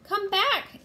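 high, loud call to return; shouting. A narrator's reading. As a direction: yell after someone running off. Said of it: "Annie shouted".

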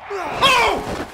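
sudden high scared shout; startled shriek. Real, not acted. Gamer jolted by a jump scare, shrieking.

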